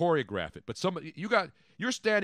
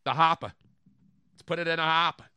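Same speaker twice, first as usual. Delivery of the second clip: put-on Boston accent; 3 semitones higher than usual. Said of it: "they do the whole Boston accent".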